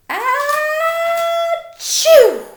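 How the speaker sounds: huge high sneeze, very loud; big sneeze. Acted. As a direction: let out a giant bear sneeze. Bear sneezes in story.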